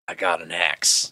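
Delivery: lightly put-on New Zealand accent, low and gruff; New Zealander accent. Mocking a LOTR fighter in a mild New Zealand accent. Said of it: "That's my version of a New Zealander accent".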